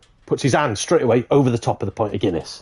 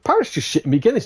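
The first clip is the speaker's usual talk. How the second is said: higher, quick mock indignation; acted protest, pitched up and hurried; indignant complaint; 7 semitones higher, faster than usual. Customer complaining to barman about the parrot, indignant.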